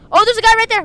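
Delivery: high, loud alert; urgent callout. Real, not acted. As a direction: shout that you have spotted someone. Spotting a player.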